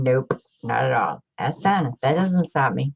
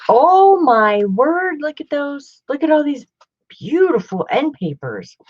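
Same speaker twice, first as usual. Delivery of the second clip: thrilled exclaim; 10 semitones higher, 4 dB louder than usual. Real, not acted. Marveling at the end papers with viewers, a high thrilled exclaim.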